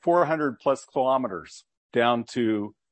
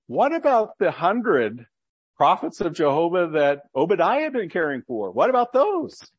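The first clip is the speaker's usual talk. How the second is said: incredulous question; 5 semitones higher, 3 dB louder than usual. Real, not acted. Rhetorical challenge in sermon.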